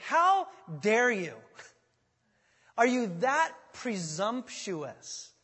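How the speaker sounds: slow, loud mock indignation; ticked off. Preacher voicing Paul scolding the Corinthians, slow and angry. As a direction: draw it out as an outraged scolding. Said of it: "He's angry. He's ticked".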